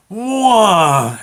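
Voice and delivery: loud, rising-pitch speeding noise; whooshing wail. Retold or imitated. Father bursting out of fog on skis, loud whoosh. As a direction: imitate someone rocketing past.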